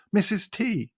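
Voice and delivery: high, adoring Missus T; fond nickname. Retold or imitated. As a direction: breathe the name like a beloved idol. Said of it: "not literally".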